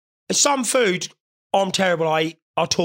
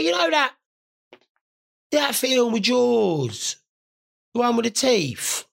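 higher mum impression; lightly voiced mum; imitating mum; 6 semitones higher, slower than usual. Mother quoted.

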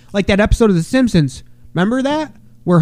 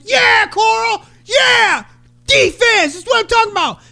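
coach shouting. Retold or imitated. Coach cheering Coral's defense from the sideline, shouting. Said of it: "The coach is shouting from the sideline".

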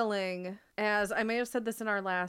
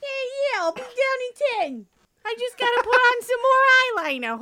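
loud, very high squeaky mouse voice; little cartoon mouse; 13 semitones higher, 9 dB louder than usual. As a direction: shout back as a cartoon mouse. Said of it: "voice of that little blue mouse from Cat Dog".

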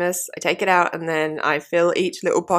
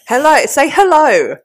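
loud, high greeting; loud hello; 7 semitones higher, 7 dB louder than usual. Acted. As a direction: call out hello brightly. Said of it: "No, louder".

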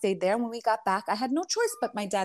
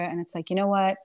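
ordinary talk, nothing marked

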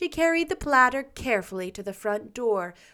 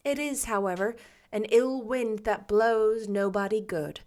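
ordinary delivery

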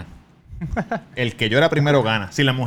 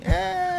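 sudden very high softer startled eh; startled high yelp; 3 dB quieter than usual. Retold or imitated. He spins around at the airport and yelps, very high startled eh. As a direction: jump as if caught.

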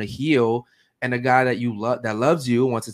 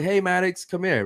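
light, higher casual call of the son's name; friendly call; 6 semitones higher than usual. Shown as a demonstration. Dad casually calling his little son over, light friendly call. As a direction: call him over easy, nothing wrong. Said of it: "There's different tones".

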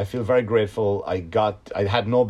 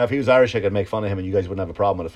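ordinary talk, nothing marked

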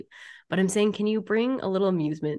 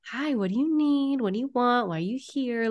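high, singsong friendly questioning; curious welcome; 6 semitones higher than usual. Acted. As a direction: greet it with open, lilting curiosity. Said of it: "Like, being curious".

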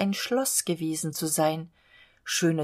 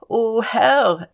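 louder, strained groan of exhaustion; 4 semitones higher, 8 dB louder than usual. Acted. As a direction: gasp it out, worn out from flying. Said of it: "ächzte".

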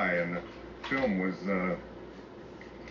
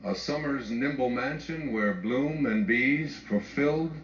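poem recital; 3 semitones higher, slower than usual. Acted. Speaker reciting Emily Dickinson to the audience, slow hushed verse. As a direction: let each image settle like dust. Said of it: "and she would say".

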